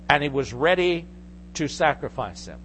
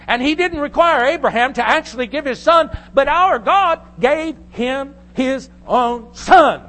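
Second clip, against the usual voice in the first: loud, high-pitched impassioned sermon; fervent pulpit proclamation, hammering key words; full-force preaching; 8 semitones higher, 7 dB louder than usual. Real, not acted. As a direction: drive the point home to the whole congregation.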